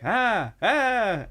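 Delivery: high, weak imitation screams; two feeble high attempts at a metal scream; practice scream. Friends trying her death metal scream, badly imitated. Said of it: "they're actually trying it afterwards".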